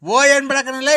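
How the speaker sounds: loud, slow, furious yell; furious shout. Acted. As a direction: bellow at him to stop. Angry caller in phone quarrel. (ಜೋರಾದ ಕೋಪದ ಕೂಗು)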